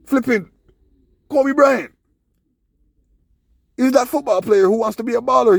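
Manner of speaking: very high, loud outbursts; exasperated shouting. Real, not acted. Man throwing famous names at doubting listeners, exasperated shouting. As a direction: shout each one out as proof.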